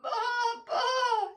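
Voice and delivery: high, loud baa-baa; sheep's bleat. A narrator's reading. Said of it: "said the red fat hen".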